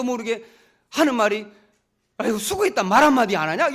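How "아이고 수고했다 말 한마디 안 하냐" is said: sarcastic complaint. Retold or imitated. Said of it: "요렇게, 요렇게 했던 거죠".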